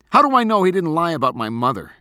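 loud, high, angry challenge; heated accusation. A narrator's reading. Boy doubting his father's word, angrily accusing. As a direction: throw the question out in anger.

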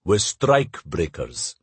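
low, slow, grim reply. Acted. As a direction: state it grimly, dragging the pace. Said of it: "Lev said grimly".

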